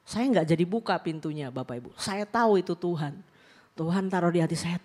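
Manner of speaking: ordinary delivery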